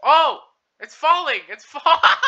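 high excited yelling; excited shout. Real, not acted. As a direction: yell as it falls. Car falling in game.

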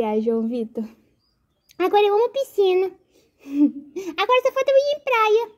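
put-on high, eager small boy; happy little boy voice. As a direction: chirp with excitement. Voicing son doll.

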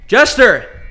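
loud call through the house. Acted. Looking for the vanished Jester, calling out. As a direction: holler for someone missing.

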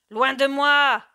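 furious, high, very loud rejection; thundering shout. A narrator's reading. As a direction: thunder it at someone to drive them away. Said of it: "lui cria le proscrit d'une voix foudroyante". (cri d'une voix foudroyante)

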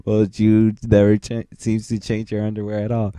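normal talking voice

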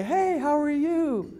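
idealized friendly phone agent, mock sugary; friendly helper. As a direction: sweet-talk the caller like a dream rep. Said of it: "I'd want him to say".

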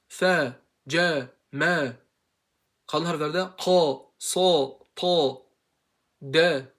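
"Fe, ce, me, ka, sa, ta" con boca abierta; fatha drills. Shown as a demonstration. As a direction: abre bien la boca en cada sílaba. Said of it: "Fetha'da açılıyor ağız".

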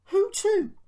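high, loud astonished outburst; loud, high-pitched disbelief; surprised. A narrator's reading. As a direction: blurt it out, startled and shrill with surprise. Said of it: "The driver was surprised".

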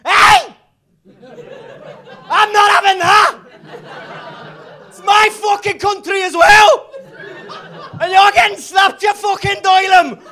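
hammed-up angry Liverpool yell; furious shout. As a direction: roar it like a bouncer stepping in. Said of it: "just suddenly went".

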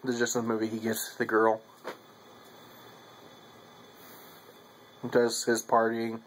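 talking around pipe stem; speaking while smoking pipe; pipe in mouth. Real, not acted. Seen 1: Pipe stem held in mouth corner most frames; briefly removed ~6-7s.

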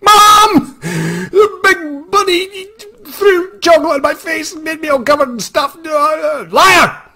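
put-on high, loud bawling child; crying kid. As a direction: sob and shout to mom like a wronged kid.

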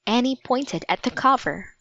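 ordinary delivery, nothing marked

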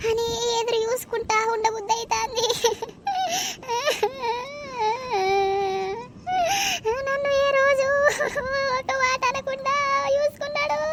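high, soft wail of grief; crying. Acted. Widow mourns her husband to relatives, crying. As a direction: sob through the words, breaking between phrases. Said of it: "ఏం ఏడుస్తావే".

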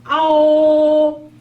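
아오 stretched slowly, high and loud; long drawn 아오. Shown as a demonstration. Teacher modelling a slow 아오. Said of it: "이렇게 하시면 돼요".

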